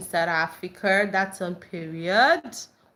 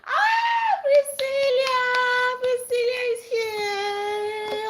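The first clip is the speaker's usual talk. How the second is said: thrilled sing-song greeting; excited shout; much slower than usual. Real, not acted. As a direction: sing her name out with delight. Greeting a friend joining live.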